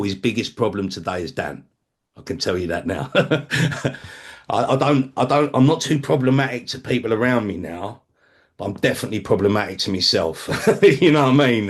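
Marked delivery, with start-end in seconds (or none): 3.1-4.5 a short airy laugh at himself; rueful self-mocking chuckle; rueful breathy laugh. Real, not acted. 10.7-11.7 laughing. Real, not acted. Laughing after self-admission.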